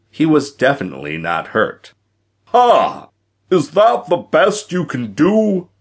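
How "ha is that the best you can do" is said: high-pitched, booming scorn; mocking giant. A narrator's reading. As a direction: jeer like an amused giant. Amused giant mocks the hero.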